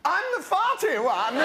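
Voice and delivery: high, silly prince voice; Edward. Acted. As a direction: chirp it as the daft youngest brother. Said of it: "I'm Edward".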